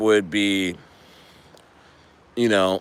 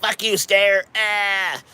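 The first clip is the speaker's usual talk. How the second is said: mocking the team; 7 semitones higher, slower than usual. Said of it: "they're like".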